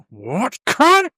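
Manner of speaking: high, rising outraged cry; hammed-up indignant challenge; mock outrage. Imagining someone kicked your dog, mock outrage.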